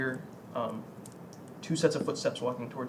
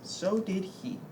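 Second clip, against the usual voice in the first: soft, higher woman's voice; woman's retort; 5 semitones higher, 5 dB quieter, slower than usual. Acted. Woman answers the mourner about his friend, soft higher voice. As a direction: answer lightly and softly, pitched up. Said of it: "She goes".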